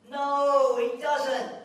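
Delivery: loud, high "No, he doesn't!"; emphatic shout. Real, not acted. Preacher shouts the denial to the congregation, loud and high.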